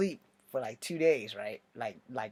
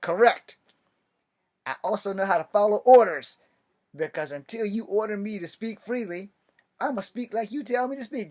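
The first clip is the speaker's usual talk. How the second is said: higher, louder formal answer; hammed-up by-the-book reply; loud military reply; 7 semitones higher, 7 dB louder than usual. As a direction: sound off like a soldier. Proud formal reply to sergeant.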